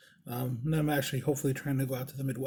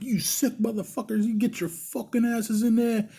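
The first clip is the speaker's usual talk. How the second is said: high-pitched mock tirade; hammed-up angry rant, pitched well up; pretend furious; 8 semitones higher than usual. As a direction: chew out the staff in fake outrage. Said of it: "just acting a fucking fool".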